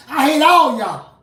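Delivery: loud, high, emphatic denunciation; angry preaching tirade. Real, not acted. Preacher telling hate groups of his hatred, loud and angry. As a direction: hammer each phrase in open anger.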